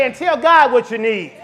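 loud, high, shouted preaching; preaching at full force. Real, not acted. Pastor shouting faith to the congregation, full-force preaching. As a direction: whip the church up into praise.

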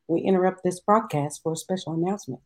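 grave 1940s war broadcast. Acted. Mock broadcast interruption.

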